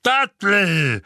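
loud, high, booming cry; Onkel Vernon, booming. A narrator's reading. Uncle booms his son's name, demanding. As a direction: boom out the name. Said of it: "dröhnte Onkel Vernon".

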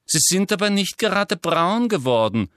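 high, sugary-sweet Umbridge; prim, falsely sweet schoolmistress. Acted. Umbridge voiced in audiobook.

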